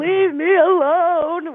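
high, slow, wailing leave me alone; high drawn-out crying whine; crying plea. Retold or imitated. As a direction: wail it like a sobbing woman under the covers. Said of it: "while you're crying".

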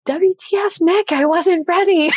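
high, mock-outraged yell; indignant shout. Friend who missed the photo moment, shouting indignantly. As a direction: holler a comic protest across the campsite.

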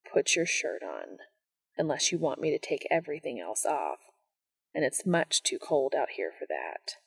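low, soft seductive tone. Acted. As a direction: murmur it close to him. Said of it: "his voice low between them".

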